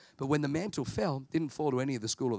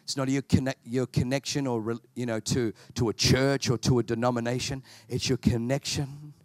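lower, earnest altar-call prayer voice; closing prayer; 3 semitones lower, 4 dB louder than usual. Acted. Preacher praying over the congregation, earnest low prayer voice. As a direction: pray over the room with conviction.

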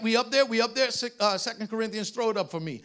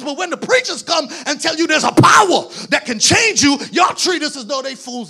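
loud, fast, half-sung preacher's build; full-force preaching; 4 semitones higher, 6 dB louder, faster than usual. Acted. Preacher driving the point home to the church, full-force preaching. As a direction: preach it hard, riding the rhythm up.

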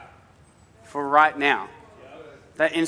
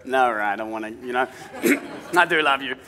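softened dad giving in; 7 semitones lower, 4 dB quieter than usual. Retold or imitated. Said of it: "a little bit of me goes".

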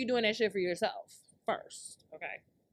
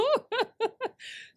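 high cackling laugh; real, high-pitched giggling fit; burst of laughter; 3 dB louder than usual. Real, not acted. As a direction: crack up mid-story. Cracks up at own story.

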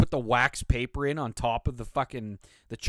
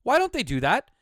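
higher, louder indignant repeat; exasperated; 8 semitones higher, 5 dB louder than usual. Real, not acted. Host fuming about missing wax paper, higher exasperated repeat. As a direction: demand an answer, fed up.

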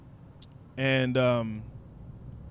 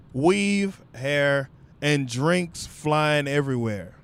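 usual speaking voice

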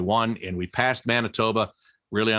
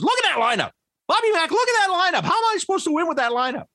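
imitating a mad coach; faster than usual. Head coach griping to broadcaster about called-up players, mock angry rant. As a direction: ham up the coach's frustrated rant about his lineup. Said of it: "and he was mad".

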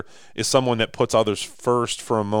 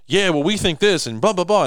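mock arguing back; 10 semitones higher, 5 dB louder than usual.

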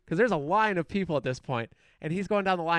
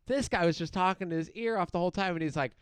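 usual speaking voice

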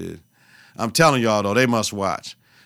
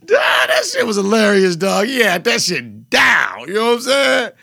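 laughing shout; 8 semitones higher, 4 dB louder than usual. Real, not acted. Laughing hard at the podcast.

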